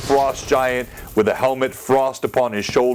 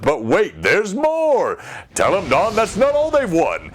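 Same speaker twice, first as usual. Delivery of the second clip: infomercial pitchman; 6 semitones higher than usual. Pretend. Parodying a TV pitchman.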